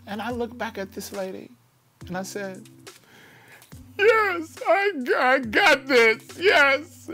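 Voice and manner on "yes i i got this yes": much higher, louder, crying "yes, I got this"; high, loud tearful reply. Retold or imitated. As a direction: sob it out while running out of breath. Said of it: "And I'm crying and can't breathe".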